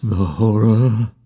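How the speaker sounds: slow, haunted echo; menacing whispered echo. A narrator's reading. Dusk repeating his last words, slow and menacing. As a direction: draw it out as an ominous echo, held back. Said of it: "in a whisper that seemed to swell menacingly".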